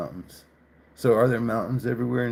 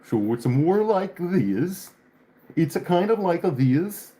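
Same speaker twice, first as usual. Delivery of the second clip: slow, higher-pitched mock Russian Swedish accent; 7 semitones higher, slower than usual. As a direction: drag the words out in a joking Russian Swedish accent. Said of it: "That's more like a Russian Swedish accent".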